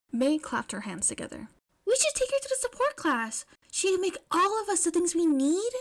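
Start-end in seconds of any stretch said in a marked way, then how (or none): 1.9-5.7 high, bright burst of eagerness; excited. A narrator's reading. May gushing to the classmates, high and thrilled. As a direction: pitch it way up, thrilled with the idea.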